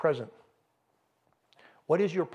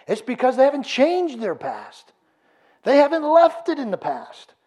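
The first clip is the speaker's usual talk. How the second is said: louder, higher, fervent preaching; preaching at full force; 5 semitones higher, 4 dB louder than usual. Acted. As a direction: preach it out to the whole room.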